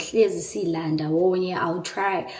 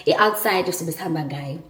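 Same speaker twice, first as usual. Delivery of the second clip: ordinary talk, nothing marked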